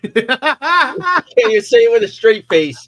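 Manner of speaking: laughing through the words; cracking up mid-sentence. Real, not acted. Laughing at cohost's list.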